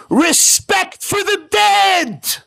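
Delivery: high, slow, roaring rebuke; furious shout. Retold or imitated. Rabbi yelling at the teenager at the funeral, furious shout. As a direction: bellow it like an outraged rabbi. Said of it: "He lost it".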